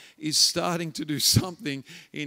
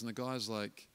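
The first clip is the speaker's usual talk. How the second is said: usual speaking voice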